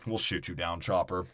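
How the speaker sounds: low, soft military deadpan; mock monotone. Soldier warning a chopper, mock monotone. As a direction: drone the warning without any urgency. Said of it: "by my monotone language".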